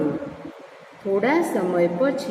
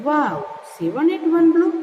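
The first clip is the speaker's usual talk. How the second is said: high-pitched storybook amazement, a little louder; amazed self-talk; 8 semitones higher, 4 dB louder, much slower than usual. A narrator's reading. Boy says to himself after killing flies, amazed. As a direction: lift the voice high in wonder. Hero marvels at his feat.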